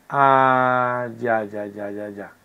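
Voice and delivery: very low, trailing-off murmur; drawn-out low "ah", then fading repeats; dawning realisation. Real, not acted. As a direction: murmur to yourself as it clicks. Realises what the exercise asks.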